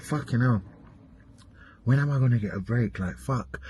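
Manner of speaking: low, soft and worn out, cursing under the breath; weary muttered curse. Retold or imitated. Mimicking a fed-up friend, muttering quietly. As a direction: sigh it out like you're fed up. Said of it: "you're just like".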